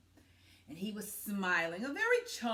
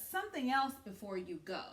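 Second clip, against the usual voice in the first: plain reading voice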